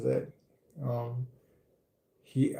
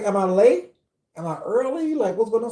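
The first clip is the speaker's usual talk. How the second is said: surprised; 8 semitones higher, 5 dB louder than usual. Retold or imitated. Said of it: "I'm like".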